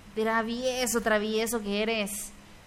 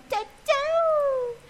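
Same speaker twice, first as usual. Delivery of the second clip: much higher put-on voice; squeaky mock goodbye; 13 semitones higher than usual. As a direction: chirp it like a cartoon. Sing-song goodbye in funny voice.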